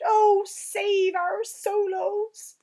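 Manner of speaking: high, loud, slow anguished giant cry; loud, furious, anguished wail; fury and anguish. Acted. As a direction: rage and despair at the nightmare. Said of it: "His voice was filled with fury and anguish".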